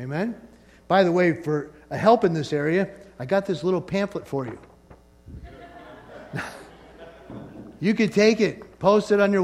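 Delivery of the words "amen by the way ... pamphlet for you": normal talking voice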